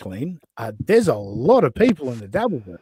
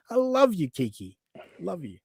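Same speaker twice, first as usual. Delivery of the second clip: fading mock tenderness; 2 semitones higher, 8 dB quieter than usual. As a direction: coo it, then fade out.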